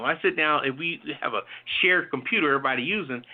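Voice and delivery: ordinary talk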